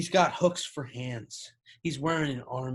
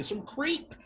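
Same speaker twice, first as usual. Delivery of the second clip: ordinary delivery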